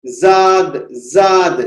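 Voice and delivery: जाद said light, like a z; wrong pronunciation. Shown as a demonstration. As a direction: demo the mistake: जाद with a plain z sound. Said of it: "जाद गलत तलफ्फुज है".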